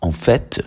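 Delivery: "fait" ending on an audible T; pronounced final T. Shown as a demonstration. Teacher showing students you say the T, sounded final T. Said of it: "you say the T".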